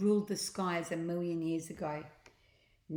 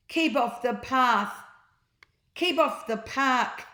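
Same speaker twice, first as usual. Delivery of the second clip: louder, higher, slow bossy command; stern sign; 6 semitones higher, 8 dB louder than usual. Acted. Park keeper's sign ordering people off the grass, loud and stern. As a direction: bark it like a strict warning sign.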